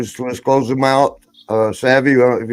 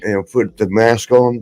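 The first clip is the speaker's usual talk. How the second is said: normal talking voice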